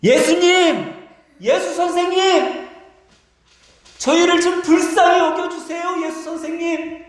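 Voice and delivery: very high, sustained pleading cry; full-throated shouting. Acted. Lepers begging Jesus to notice them, full-throated high shouts. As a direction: call with all your voice, drawing out the words. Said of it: "온 목청을 다해 소리를 질러요".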